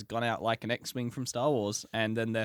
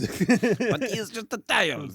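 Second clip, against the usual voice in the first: slow, high French headmistress impression; French accent; 9 semitones higher, 6 dB louder, much slower than usual. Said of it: "Madame Beauxbatons from, um, s-, Harry Potter".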